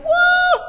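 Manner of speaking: loud, high cheering whoop; imitated woman's whoop. Preacher showing congregation what the woman did, loud high whoop. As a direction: whoop it out in mimicked celebration. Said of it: "She was like".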